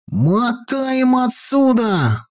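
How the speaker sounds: high, stretched alarm cry without real volume; mock shouting. A narrator's reading. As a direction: urge a getaway in a high boy's voice. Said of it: "закричал Петька".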